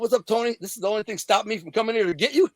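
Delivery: high-pitched cocky teasing, breaking into a laugh; retold joking taunt with a laugh after; laughing wise guy. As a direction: bust his balls like a wise-guy kid. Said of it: "Just laughing, being a wise guy kid, busting balls".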